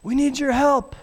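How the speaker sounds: put-on high, fast, breathless kid voice; a kid out of breath calling for dad; breathless child. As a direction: play the panicked child bursting in. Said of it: "out of breath".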